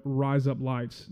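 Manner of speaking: "Rise up lights" stretched long, each word separate; slow and drawn out. Shown as a demonstration. Showing the crowd the long way of saying it, slow and separated. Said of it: "that long".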